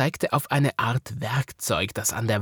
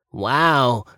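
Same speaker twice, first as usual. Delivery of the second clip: louder wow of amazement; astonished wow; 3 semitones higher, 6 dB louder than usual. A narrator's reading. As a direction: gasp it out in wonder, louder. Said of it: "sagte Woggle erstaunt".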